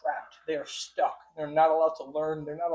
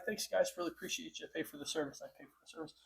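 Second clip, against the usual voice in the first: very soft, sinking sarcastic aside; sarcastic muttering; 14 dB quieter, faster than usual. Real, not acted. Paying customer thanking Open AI for wiped data, sarcastic mutter. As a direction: mutter it under your breath, getting ever softer.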